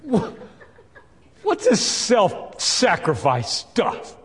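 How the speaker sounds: high, slow mock-exasperated Jesus. Preacher playfully voicing Jesus rebuking Peter. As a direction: drag it out in disbelief. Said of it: "maybe he didn't sound like that".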